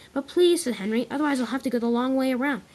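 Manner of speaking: high, loud urgent plea; pleading. Acted. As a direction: beg him to move. Henry pleading with Spencer.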